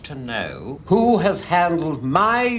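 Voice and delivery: angry demand. Acted.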